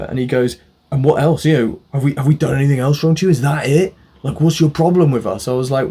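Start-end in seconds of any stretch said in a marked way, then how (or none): 0.9-5.5 higher, louder imitation of indignant challenge; retold confrontation. Mechanic challenging his complaining customer, imitated indignation. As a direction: fire off mock-aggrieved questions.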